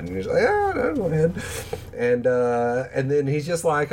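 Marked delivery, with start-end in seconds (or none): 0.5-1.3 high, sweet granny insisting; old lady voice. Retold or imitated. Imitating Rosie offering meatballs, high old lady voice. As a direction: coax him like a tiny old grandma.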